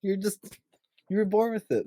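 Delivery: high-pitched mock gushing praise. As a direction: coo it like an over-impressed parent. Voicing a gushing parent's praise.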